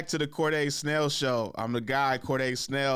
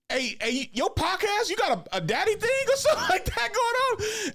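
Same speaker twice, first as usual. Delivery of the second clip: much higher, laughing mock brother voice; imitating his brother. Brother texting about the podcast, mock high confused voice. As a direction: squeak it out like the baffled twin, cracking up. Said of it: "he was like".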